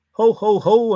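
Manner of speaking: loud jolly Santa ho ho ho; hammed-up Santa; Santa laugh. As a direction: boom it like Father Christmas.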